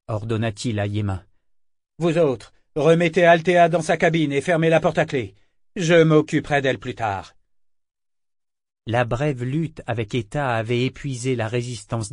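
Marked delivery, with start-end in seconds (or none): 2.0-7.3 loud, firm orders to a crew; commanding. A narrator's reading. Captain orders sailors to lock Althea up, commanding. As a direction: address the men with authority.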